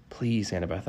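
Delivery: hushed, pleading aside; praying. A narrator's reading. Narrator privately begging his friend, praying. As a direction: plead softly under your breath. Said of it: "I prayed".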